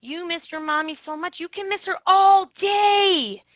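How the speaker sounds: put-on slow, tender comfort to a crying girl; gentle reassurance. To a crying preschooler, slow tender reassurance. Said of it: "I looked at her and I said".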